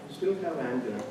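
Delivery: ordinary delivery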